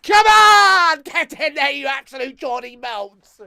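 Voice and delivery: very high, full-force celebratory yell; long, loud cry of triumph; elated shout. Real, not acted. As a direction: roar it to celebrate the goal. Celebrating the goal.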